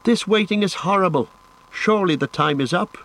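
high-pitched, impatient complaint; impatient outburst. A narrator's reading. Companion tired of waiting for Frodo, impatient. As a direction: voice a fretful character, pitched high.

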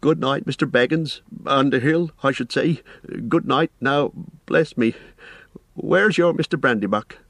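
high-pitched, bustling landlord; flustered innkeeper. Acted. Innkeeper says good night and notices a guest missing, flustered. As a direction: correct yourself, then remember something suddenly.

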